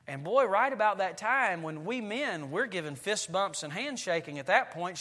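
normal talking voice

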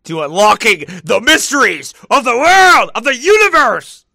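high, booming, over-the-top declaiming; hammed-up cosmic announcement; mock grand proclamation. Mock grand proclamation about conspiracy mysteries.

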